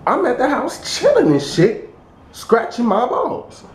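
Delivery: high, put-on mock voice; exaggerated high-pitched comeback; high-pitched retold reply. Acts out own reply to call.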